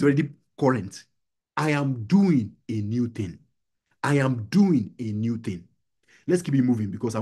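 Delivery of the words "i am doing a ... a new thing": "doing" drawn out slowly and deliberately; slow the line to weight "doing"; stress on doing. Shown as a demonstration. Stressing the present-tense verb just explained.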